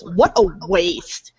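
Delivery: ordinary delivery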